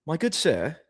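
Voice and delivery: mock British gentleman's accent. Shown as a demonstration. Streamer trying out his British accent for chat, British accent. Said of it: "What's my British accent?".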